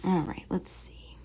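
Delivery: slow whisper to herself; soft, slow whispered self-talk. Real, not acted. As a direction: murmur it slowly under your breath. Quiet focus while drawing.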